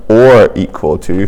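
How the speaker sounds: "or" stretched for emphasis; stress on OR. Shown as a demonstration. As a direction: lean slowly on the word OR. Teacher stresses OR in the condition.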